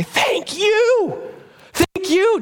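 high, bright repeated thank you; joyful gift thanks; gushing thanks. Pretend. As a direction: overflow with gratitude. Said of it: "we will say".

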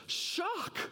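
shocked, rising high twice; mock shock. Shown as a demonstration. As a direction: sound scandalised at the word shock. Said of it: "Satan's statement here is to express shock".

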